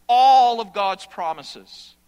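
loud, slow preaching of "All of God's promises"; thundering pulpit cry; proclaiming loudly. Acted. Preacher builds to a climax.

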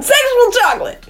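mock shout. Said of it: "I just wanna shout".

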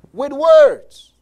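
indignant Esau. Acted. Esau's punchline in acted dialogue.

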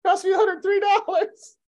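very high comic whine of protest; hammed-up squealed complaint; mock outrage. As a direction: wail about the money in jest. Comic complaint about the bill.

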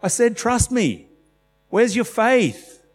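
high, exasperated chiding; a lightly voiced exasperated Jesus. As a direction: scold the disciples like a tired teacher. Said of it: "he was constantly having to say".

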